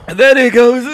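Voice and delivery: high loud pained outburst; burning yelp. Real, not acted. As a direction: cry out at the heat. Reacting to hottest chili.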